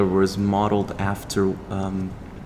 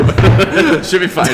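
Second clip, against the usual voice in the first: laughing through the words, higher and louder; real laughter; 9 semitones higher, 9 dB louder, much slower than usual. Real, not acted. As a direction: laugh it off, half-nervous. Laughing about interrogation-like lighting.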